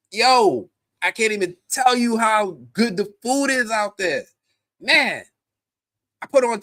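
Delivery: high, loud food hype; worked up, high-pitched gushing; excited raving. Real, not acted. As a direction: rave about it at full enthusiasm. Raves about North Carolina food.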